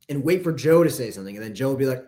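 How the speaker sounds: normal talking voice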